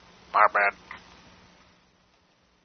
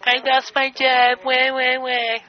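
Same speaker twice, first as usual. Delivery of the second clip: high, slow whiny fake sob; mock crying; 11 semitones higher, slower than usual. Mocking the fired worker with a high fake wail. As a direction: whimper like a baby mocking her. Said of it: "speak like a man".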